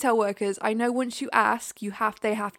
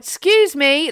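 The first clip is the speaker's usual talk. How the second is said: loud, sharp, higher excuse me; aggressive excuse me; 5 semitones higher, 10 dB louder, slower than usual. Retold or imitated. Runner to family blocking footbridge, aggressive bark. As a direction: bark it to clear the path. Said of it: "really aggressively".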